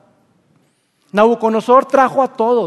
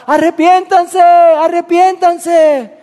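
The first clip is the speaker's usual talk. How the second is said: high, loud, drawn-out cry from the pit; a prophet's desperate long shout; 7 semitones higher, 6 dB louder, much slower than usual. Retold or imitated. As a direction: call out to the whole city from below. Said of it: "Desde ahí Jeremías gritaba".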